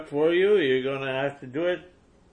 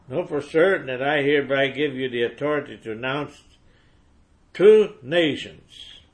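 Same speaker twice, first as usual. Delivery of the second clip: emphatic preaching; 3 semitones higher, 7 dB louder than usual. Acted. Preacher to congregation, proclaiming the commission loudly.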